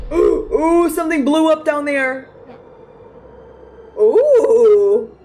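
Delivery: high, loud oohs at the blast; real thrill at the explosion; shocked and thrilled by the boom; excited gasping. Real, not acted. Explosion heard in game.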